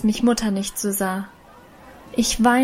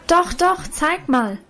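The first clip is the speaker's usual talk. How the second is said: eager call; 7 semitones higher, slower than usual. A narrator's reading. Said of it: "rief nun aber auch schon Lina".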